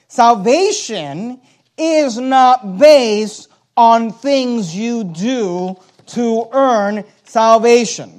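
slowly, word by word, leaning on "based" and "earn"; slow emphatic. Shown as a demonstration. Preacher spelling out doctrine, slow and weighty. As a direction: drag out "Salvation is not based on things you do to earn salvation" with weight. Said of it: "So let me be clear".

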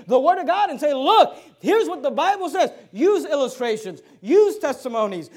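loud, punchy sermon exhortation; forceful preaching. Real, not acted. Preacher urging soul winners to open the Bible, loud and emphatic. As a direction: drive each command home to the congregation.

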